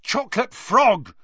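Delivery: high, loud exclamation; angry yell. A narrator's reading. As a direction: yell it out in frustration. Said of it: "he yelled angrily".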